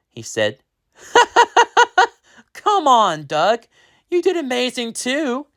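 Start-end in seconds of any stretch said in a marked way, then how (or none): 1.1-2.1 a squeaky, delighted laugh; high bright laugh. Acted. Character laughs. 2.5-5.5 laughing reply. Acted. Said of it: "he laughed".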